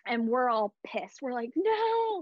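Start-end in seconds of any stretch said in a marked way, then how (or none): 1.5-2.2 "No" yelled much higher and louder; retold scream. The crowd in the arena as Vegas ties it, outraged yell. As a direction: yell "No" in outrage as the game is tied. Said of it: "like, we're screaming".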